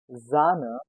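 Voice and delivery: Sahne said "ZAH-neh"; German pronunciation. Shown as a demonstration. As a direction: buzz the S of Sahne as z. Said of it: "You have to notice the difference".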